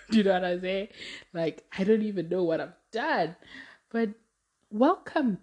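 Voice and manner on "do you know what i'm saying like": ordinary talk, nothing marked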